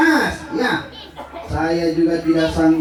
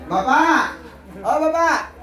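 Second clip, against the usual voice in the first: memanggil bapak; 5 semitones higher, slower than usual. Retold or imitated. Gadis memanggil ayahnya.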